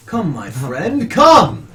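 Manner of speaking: beckoning call, rising high and loud on the last word. Acted. Priest calls the patron over to him, calling out. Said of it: "he calls to you".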